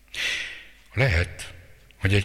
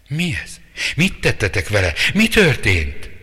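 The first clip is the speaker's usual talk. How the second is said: higher, louder desperate outcry through tears; crying out through tears; 6 semitones higher, 7 dB louder than usual. Acted. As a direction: cry it out, on the edge of sobbing. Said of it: "könnyeken keresztül kiáltotta". (könnyek között kiáltva)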